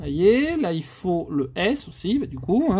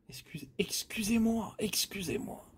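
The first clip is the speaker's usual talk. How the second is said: much softer, a whispered sorry; whispered apology; 3 semitones higher, 11 dB quieter than usual. Real, not acted. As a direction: whisper the excuse under your breath. Apologises for a missed word. (excuses chuchotées)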